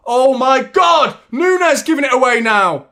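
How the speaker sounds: loud, slow "oh my God" shout of disbelief; real frustration, yelled at the screen; exasperated outburst. Real, not acted. Fan yelling at a misplaced pass, exasperated.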